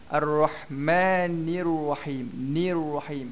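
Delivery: "Ar-Rahmanir Rahim" slow, clear "i" in "Nir"; clear kasrah. Shown as a demonstration. Teacher drills careful joining, clear "i" in "Nir Rahim". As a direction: dwell on "Nir" before gliding into "Rahim". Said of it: "kita kena ambil dulu".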